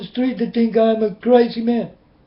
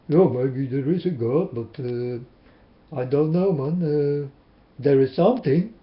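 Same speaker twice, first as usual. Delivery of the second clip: doubting skeptic; 6 semitones lower, 4 dB quieter than usual. Retold or imitated. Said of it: "Many people are like this".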